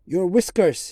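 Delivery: loud cry, pitched high; a mad outburst of a cry. A narrator's reading. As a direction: shout it with blazing eyes. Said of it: "he cried".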